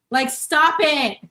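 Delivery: slow, loud, high squeal of delight; gushing. Real, not acted. Showing a viewer's children's book, loud slow squeal. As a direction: melt over something too cute.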